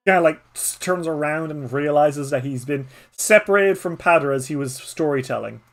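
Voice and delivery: ordinary talk, nothing marked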